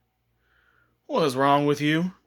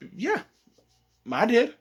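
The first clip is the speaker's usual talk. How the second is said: high proud boast; bragging; 9 semitones higher than usual. Retold or imitated. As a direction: show off, pleased with yourself.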